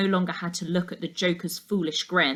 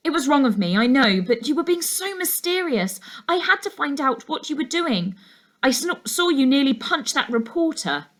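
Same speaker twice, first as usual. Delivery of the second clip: put-on higher, guilty girl's voice; a girl owning up, pitched up; young girl Laura; 7 semitones higher than usual. A narrator's reading. Laura confesses to Calvin.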